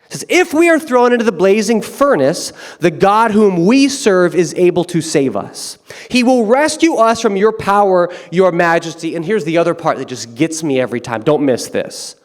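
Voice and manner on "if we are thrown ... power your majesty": higher, louder fearless reply; bold defiant declaration. Pretend. The three men answering the king, loud and bold.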